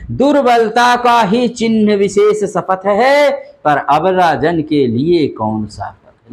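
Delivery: measured spoken recitation of poetry; recited verse. Retold or imitated. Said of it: "तो कह रहा है कि".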